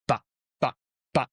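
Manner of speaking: short "bah" for each gunshot; imitated gunshots. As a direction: mimic three spaced shots with the voice.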